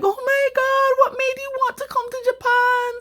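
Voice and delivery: high, delighted, fawning welcome; gushing Japanese locals. Retold or imitated. Kind locals greeting the Jamaican newcomer, high and gushing. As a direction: gush over a foreigner like a thrilled host. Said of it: "they're like".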